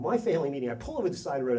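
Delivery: ordinary talk, nothing marked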